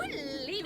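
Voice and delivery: soft, long intake of breath; dramatic gasp. Pretend. Player watching her scheme, dramatic gasp. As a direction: draw in a theatrical gasp.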